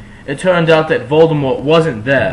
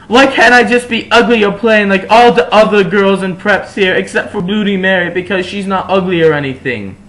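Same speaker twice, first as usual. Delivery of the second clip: put-on high, loud teenage complaint; loud whiny teenage rant; 7 semitones higher, 5 dB louder than usual.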